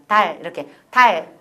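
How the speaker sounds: 달 ending with the tongue tip at the front; tongue tip forward. Shown as a demonstration. Teacher modelling Korean, 달 with forward tongue. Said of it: "혀 끝을 앞에 보이라".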